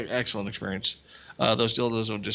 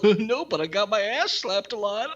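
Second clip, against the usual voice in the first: higher, giggly put-on voice; mock giggly guy; 10 semitones higher than usual. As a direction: play him giggling over the memory. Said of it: "He's all".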